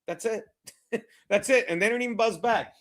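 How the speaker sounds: ordinary talk, nothing marked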